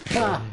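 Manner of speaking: single loud fake "Ha"; fake laugh. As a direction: blurt one loud deliberate laugh. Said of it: "There's my laugh".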